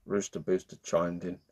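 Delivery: usual speaking voice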